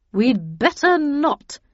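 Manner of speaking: slow, icy refusal; cold, deliberate put-down. A narrator's reading. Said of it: "replied Miss Havisham coldly".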